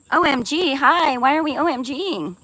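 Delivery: high, loud "O M G, hi"; excited greeting. Real, not acted. Streamer greeting a viewer, excited and high. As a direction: greet her with delight.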